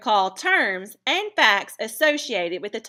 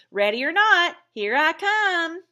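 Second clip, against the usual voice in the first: excited call; 8 semitones higher, 7 dB louder than usual. Shown as a demonstration. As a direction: sing it out teasingly. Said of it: "I've started the sentence a little differently".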